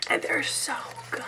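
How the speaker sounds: very soft, breath-only whisper; hushed, voiceless rave; whispered gushing. Real, not acted. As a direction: whisper it as if overwhelmed by how good. Gushing over the brushes.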